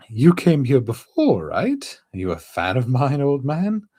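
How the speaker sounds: lively high character voice; high skeleton voice. Acted. Voices Brook.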